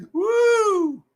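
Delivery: loud high woo; wrestler woo. Pretend. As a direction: whoop like a wrestler. Wrestling taunt.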